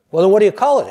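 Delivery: a big Texan calling out a challenge; loud Texan. Retold or imitated. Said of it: "asks John".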